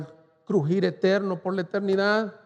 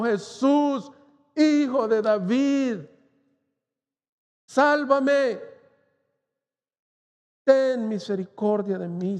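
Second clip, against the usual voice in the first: higher, slow cry for mercy; pleading like Bartimaeus; 5 semitones higher, slower than usual. Pretend. Preacher praying as the blind man to Jesus, pleading cry. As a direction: call out as if you were the blind man. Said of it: "Como el ciego Bartimeo".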